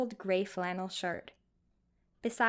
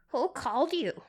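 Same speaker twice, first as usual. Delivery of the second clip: higher, louder wary question; suspicious old man; 6 semitones higher, 5 dB louder than usual. A narrator's reading. Old man questioning the nurse, suspicious. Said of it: "Mister Spheres asked".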